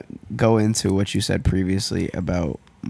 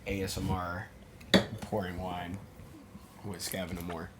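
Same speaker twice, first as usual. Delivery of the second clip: soft near-whisper ASMR intro; 17 dB quieter than usual. Pretend. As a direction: murmur it like an ASMR video. Hosts pour wine ASMR-style.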